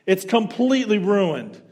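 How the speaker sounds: loud, high furious tirade; angry yell. Retold or imitated. Angry man yelling at the waitress over his suit. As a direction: rip into the waitress at full volume.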